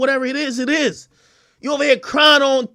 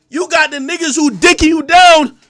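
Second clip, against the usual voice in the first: normal talking voice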